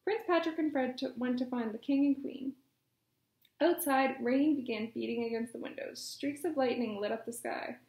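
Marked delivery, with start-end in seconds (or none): none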